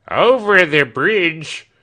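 slow, loud high shout; crying out. Acted. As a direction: call it out across the road. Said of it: "cried Britain".

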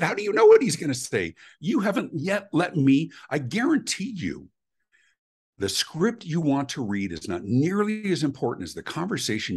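high-pitched, emphatic customer protest, slowing in the middle; exasperated argument. Retold or imitated. Caller to agent who predicts the supervisor's answer, exasperated argument. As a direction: re-enact lecturing an agent in exasperation.

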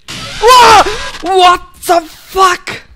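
terrified shriek at a jumpscare; scared scream. Real, not acted. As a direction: scream in sudden real fright. Jumpscare in horror game.

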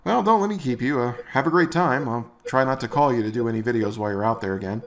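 usual speaking voice

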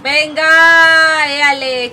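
excited cheer. Real, not acted. Streamer cheering a good play, loud excited cheer. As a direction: shout it out to celebrate a play.

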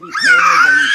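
laughing hard while talking; laughing shout. Real, not acted. Grandma laughing during tickle chaos.

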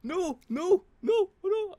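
high, frantic rapid-fire no's; panicked. Real, not acted. Gamer reacting to disaster on screen, panicked. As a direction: yelp in rising panic. Player panics as game turns bad.

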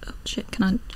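panicked whisper. Real, not acted. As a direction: hiss it under breath.

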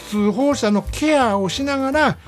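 ordinary talk, nothing marked

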